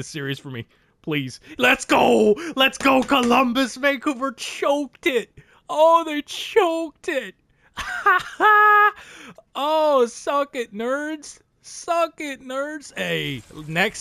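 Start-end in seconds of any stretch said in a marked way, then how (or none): 1.6-7.3 much higher, louder excited shout. Real, not acted. Cheering Columbus on. 7.8-9.5 high, loud triumphant laugh; gleeful loud laugh. Real, not acted. Gleeful over rival choking. 9.6-12.9 gloating taunt. Real, not acted. Taunting the rival team.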